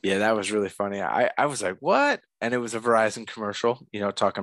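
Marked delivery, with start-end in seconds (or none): 1.8-2.2 high surprised what. Retold or imitated. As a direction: squeak out a stunned what. Retold surprised reaction.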